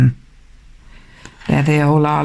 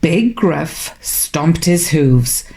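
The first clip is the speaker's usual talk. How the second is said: plain reading voice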